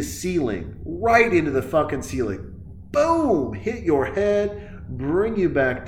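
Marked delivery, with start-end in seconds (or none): 2.9-3.5 mock explosion. Acting out the explosion.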